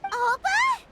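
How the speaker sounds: sudden high cry of disbelief; startled call. Acted. To Puma on learning she's T'Pol's mother, stunned high call. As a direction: gasp the name out, stunned.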